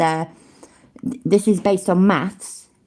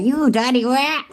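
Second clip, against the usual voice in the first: gangster voice; 7 semitones higher than usual. Retold or imitated. Gangster movie impression.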